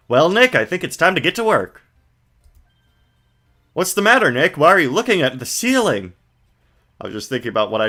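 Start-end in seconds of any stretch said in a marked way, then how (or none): 0.1-6.1 put-on higher, brighter girl voice; Maya voice. Streamer voicing Maya to the viewers, girlish and high. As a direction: play an eager young girl. Voices characters reading game text.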